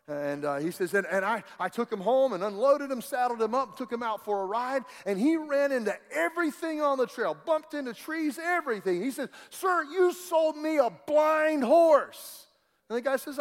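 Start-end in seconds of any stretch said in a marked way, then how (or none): 0.9-9.0 upset complaint. Retold or imitated. 9.5-12.5 loud, high and slow, fed up; a cheated cowboy lays it on the trader; indignant outburst. Retold or imitated. Angry cowboy confronts trader.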